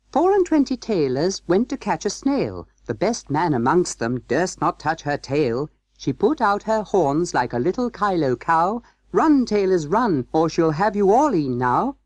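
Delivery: high, gaily chanted nursery rhyme; little mouse voices. A narrator's reading. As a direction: chant it brightly like small merry mice. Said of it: "little mouse voices sang loudly and gaily".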